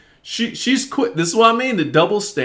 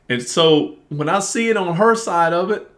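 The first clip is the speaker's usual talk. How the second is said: normal talking voice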